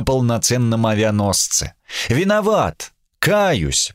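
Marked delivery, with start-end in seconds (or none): 2.1-3.9 mock apology in a higher voice; open mockery. A narrator's reading. Sam mockingly repeats his apology to the admiral, mockery. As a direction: apologize with a visible sneer in the tone. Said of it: "с видимой издёвкой в голосе".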